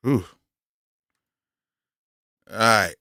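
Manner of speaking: ordinary talk, nothing marked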